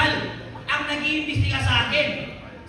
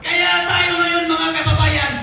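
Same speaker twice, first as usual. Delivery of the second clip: rising rally oration to the crowd; rally speech calling for Marcos to resign; 6 dB louder than usual. Real, not acted. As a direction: address the crowd with conviction.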